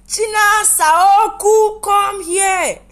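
very high, loud, slow yelled call; mother shouting. Retold or imitated. Daughter imitating her mother shouting her name. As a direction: bellow the name like an African mother calling. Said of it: "in that African mother space".

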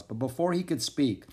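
plain reading voice

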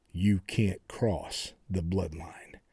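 very slow, low and soft pronouncement; low, drawn-out warning, each word weighed; hushed booming voice. Retold or imitated. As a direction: imitate a solemn voice from above, half-whispered. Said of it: "you hear this booming voice".

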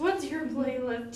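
usual speaking voice

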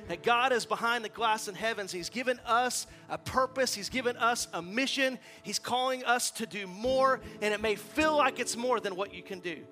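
high, loud, driving pulpit delivery; impassioned preaching. Real, not acted. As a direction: build to a forceful, emphatic climax.

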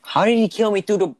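loud, high disbelief; outraged yell. Real, not acted. Killed in game, outraged.

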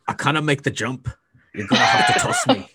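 a bad, hammed-up Scottish accent, pitch leaping high on the toss line; awful Scottish accent. Quoting a dwarf to friends in an awful Scottish accent. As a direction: butcher a Scottish brogue for a laugh. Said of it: "that was my awful Scottish accent".

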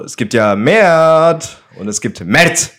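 Mert einmal weich, einmal hart, langsam und höher; two ways of saying Mert. Shown as a demonstration. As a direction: zwei Tonlagen für Mert, langsam vorgeführt. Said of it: "Kommt auf die Tonlage an". (Mert zweimal anders betont)

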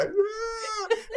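high laugh breaking into tears; choked-up laugh; teary laugh. Real, not acted. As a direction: laugh through real tears. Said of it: "I'm getting all emotional right now".

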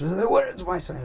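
high, loud frantic demand; demanding father. Retold or imitated. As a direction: burst out as an alarmed father. Father demands his son.

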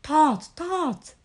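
high-pitched call of the name; storybook Frog calling out; crying out. A narrator's reading. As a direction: call out twice to wake a friend. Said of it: "cried Frog".

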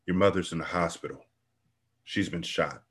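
softly, quickly, a touch hushed; grave hushed news. Acted. Midnight breaks bad news softly.

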